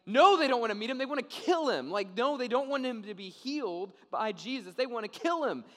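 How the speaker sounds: loud, high, emphatic rebuttal; loud comic exasperation; exasperated outburst. Real, not acted. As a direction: raise the voice to protest the absurdity. Exasperated rebuttal of the sarcastic question.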